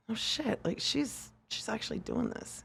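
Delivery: soft half-whispered mock surprise; hushed disbelief. Doubters realising she is actually doing it, hushed. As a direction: drop to a hush as the stunned doubters.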